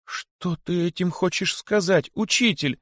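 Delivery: high, slow, horrified question; horrified cry. A narrator's reading. Disciple horrified as his teacher hints at death, high slow cry. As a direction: ask it in sudden dread. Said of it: "в ужасе воскликнул возжаждавший".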